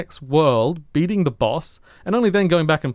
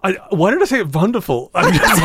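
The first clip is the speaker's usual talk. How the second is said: laughing; 7 semitones higher, 6 dB louder than usual. Real, not acted. Laughing at own word choice.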